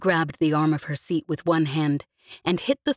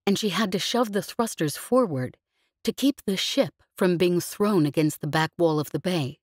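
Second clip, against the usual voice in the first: ordinary delivery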